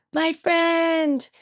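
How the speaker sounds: high, held-back imitation of a wail; grief-stricken scream. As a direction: cry out in grief, high but without full volume. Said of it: "screamed it, like, you could just hear the grief".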